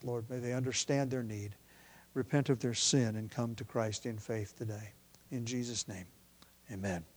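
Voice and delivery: quiet, earnest prayer voice. Real, not acted. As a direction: pray softly before the sermon. Pastor leads opening prayer.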